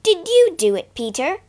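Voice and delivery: high, loud, sharp accusation; sharp question. Acted. Heidi sharply accusing Peter of wrecking the chair. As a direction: snap the question at him. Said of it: "she asked sharply".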